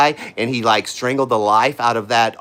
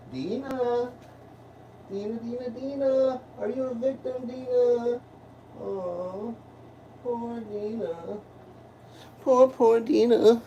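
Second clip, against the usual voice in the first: mock pity; 8 semitones higher, 7 dB quieter, slower than usual. Mocking pity toward Dina.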